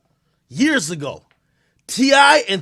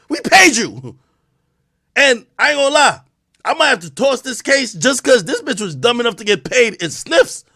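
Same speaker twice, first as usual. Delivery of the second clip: loud, higher mock courtroom ruling, half shouting; mock judge; 5 semitones higher, 5 dB louder than usual. Streamer playing judge rants at the accuser, loud and mocking. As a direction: bang the gavel and rant at the accuser. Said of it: "Judge Academics is presiding".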